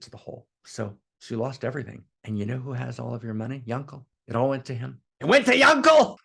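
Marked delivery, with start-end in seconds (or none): 5.2-6.2 shrieked high and loud in horror; anguished disbelief. Retold or imitated. Ruined man hearing his money went to Yankel, shrieking in disbelief. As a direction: cry it out as if the news is unbearable.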